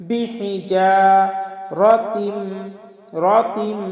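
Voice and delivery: "রতিম" ending in a held nasal hum; ghunna on meem. Shown as a demonstration. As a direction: chant it, humming the final m of "রতিম". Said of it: "এটা গুন্না হবে". ("রতিম"-এর মিমে গুন্না)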